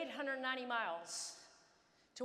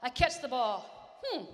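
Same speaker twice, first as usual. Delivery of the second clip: normal talking voice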